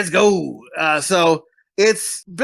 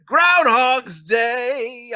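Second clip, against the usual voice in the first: very slow, drawn-out and higher announcer read; show title announcement; 6 semitones higher, much slower than usual. Acted. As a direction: savour each syllable like a show opener. Repeats episode title, announcer style.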